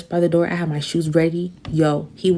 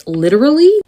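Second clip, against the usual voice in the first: excited burst; 8 semitones higher, 8 dB louder than usual. Real, not acted. Emphatic outburst.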